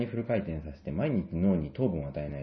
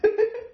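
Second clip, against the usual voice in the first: short high laugh; 4 dB louder than usual. Real, not acted. Embarrassed laugh before promo.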